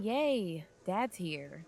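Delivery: badly faked delight for a parent; fake enthusiasm. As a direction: cheer without meaning it. Kid fakes enthusiasm after dad's rebuke.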